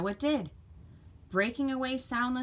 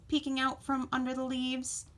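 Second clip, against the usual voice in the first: usual speaking voice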